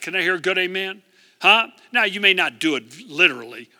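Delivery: usual speaking voice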